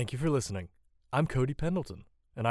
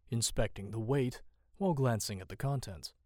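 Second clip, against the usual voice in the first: ordinary delivery, nothing marked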